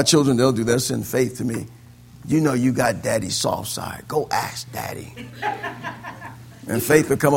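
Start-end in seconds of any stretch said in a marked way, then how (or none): 2.3-5.1 low hushed coaxing; conspiratorial whisper. Retold or imitated. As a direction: whisper the plan to the little sister. Kids urging Faith to ask.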